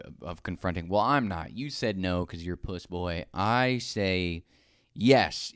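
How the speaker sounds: ordinary talk, nothing marked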